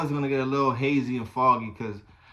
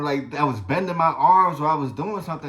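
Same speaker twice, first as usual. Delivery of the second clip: ordinary talk, nothing marked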